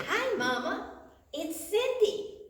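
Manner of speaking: put-on high, drawn-out, twelve-year-old-style hello; real big greeting. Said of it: "just real big".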